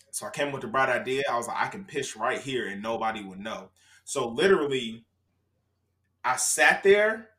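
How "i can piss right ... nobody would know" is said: much softer and lower, conspiratorial; quietly plotting to himself; sneaky thought. Retold or imitated. Retold sneaky inner thought.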